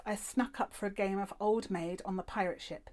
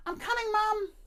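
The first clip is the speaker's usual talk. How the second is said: higher, loud call; child shouting back; 14 semitones higher, 7 dB louder than usual. A narrator's reading. The girl answering her mum, loud and higher. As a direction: call down the stairs. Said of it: "I shouted".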